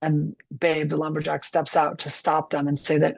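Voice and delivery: ordinary talk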